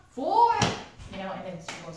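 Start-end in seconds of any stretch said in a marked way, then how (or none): 0.1-0.5 much higher, louder shouted four; shouted count. Retold or imitated. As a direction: bark the count out. Mom counting, yelling.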